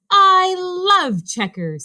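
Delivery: high, loud, stretched-out enthusiasm; thrilled, drawn-out delight; excited gushing. A narrator's reading. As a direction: gush it, leaning long on the love. Rabbit gushes about checkers.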